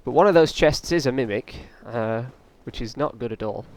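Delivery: normal talking voice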